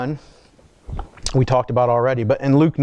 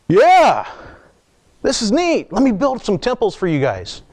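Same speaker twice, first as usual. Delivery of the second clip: mock excited disciples; 7 semitones higher than usual. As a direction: play the starstruck disciples, hammed up. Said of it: "his disciples are there going".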